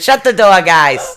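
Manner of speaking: turned off-mic, shouting to the room; turning away from phone. Real, not acted. Calling to kids off-mic.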